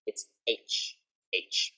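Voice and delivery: "H" breathed out softly as aitch, no voice; teacher models "H" in a whisper; letter H, whispered demo. Shown as a demonstration. Teacher showing Thai learners a soft whispered "H". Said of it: "just remember that it's H".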